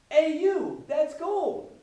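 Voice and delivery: high, loud shout after a thief; calling out. Acted. Scientist to the man running off with his gold, calling out. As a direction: yell it as an indignant accusation.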